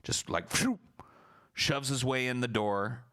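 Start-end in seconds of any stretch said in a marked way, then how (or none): 0.5-0.8 quick high whoosh for a shove; high whoosh sound. Retold or imitated. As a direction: make the sound of someone barging past. Shoving past sound.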